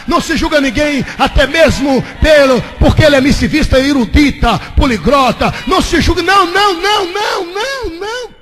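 preacher breaking into a sung refrain; rising preaching climax. Acted. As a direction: chant the repeated não, climbing. Preacher builds to a chanted climax.

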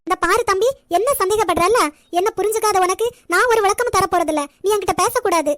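loud, hurt telling-off; angry outburst. Acted. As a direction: shout down a doubting brother, close to tears. Hurt sister cuts off brother.

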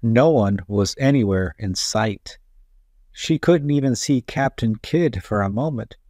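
ordinary delivery, nothing marked

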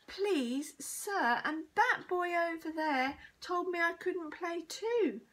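high whiny tale-telling; wailing. A narrator's reading. Bully accuses new boy to teacher, wailing. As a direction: complain in a schoolboy's wail. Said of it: "wailed Brendan the bully".

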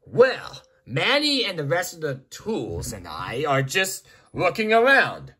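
normal talking voice

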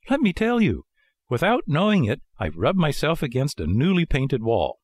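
high puppet voice, explaining; fibbing puppet Pinocchio. Acted. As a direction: offer an excuse in a high voice. Pinocchio lies to the old man.

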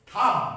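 থাম্ব, B silent; correct pronunciation. Shown as a demonstration. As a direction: say থাম্ব ending on M. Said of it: "this is not thumb".

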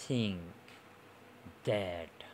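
"think", "that" with tongue between the teeth; separate, falling "think" and "that", tongue lightly bitten; voiceless th in "think", voiced th in "that"; interdental demo. Shown as a demonstration. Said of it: "দাঁত দিয়ে কামড়িয়ে ধরে উচ্চারণটা করবে".

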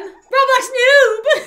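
loud, high, drawn-out call; alarmed cry. Acted. Townsperson seeing Roblox noob in danger, alarmed high cry. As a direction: wail the name in alarm. Cries out for endangered character.

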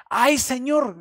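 fuerte y agudo, exclamando con angustia; grito de ay señor. Retold or imitated. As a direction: grita ay como quien pierde algo prestado. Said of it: "gritó". (grito angustiado actuado)

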